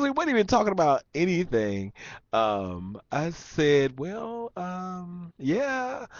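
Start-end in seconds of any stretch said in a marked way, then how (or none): 4.0-6.1 high, very soft reluctant answer; acted-out awkward hedging; hesitant reply. Retelling his reluctant answer.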